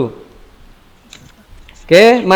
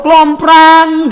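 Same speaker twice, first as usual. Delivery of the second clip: high, drawn-out clattering crash; vocal crash of crockery; falling-plate crash imitation; 7 semitones higher than usual. Shown as a demonstration. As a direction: ring it out like a dropped plate. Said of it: "Kalau Ustadz punya piring jatuh".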